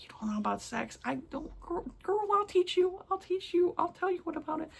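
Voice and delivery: high, soft sassy best-friend voice; sassy girlfriend. Retold or imitated. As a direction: coax her like a cheeky bestie. Imagined talk to the shy heroine.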